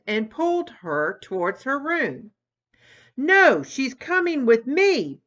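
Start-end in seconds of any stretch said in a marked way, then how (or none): 3.2-5.2 higher, louder yell; a brother blaring as he pulls the friend his way. Acted. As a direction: blare it back at her. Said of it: "blared Tommy".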